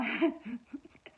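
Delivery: laugh. Real, not acted. Laughing at own story.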